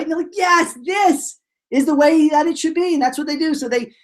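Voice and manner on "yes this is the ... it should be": high, excited mock certainty; hammed-up triumphant approval; acted delight. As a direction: declare it proudly in a high, eager voice. Acted delight at new plan.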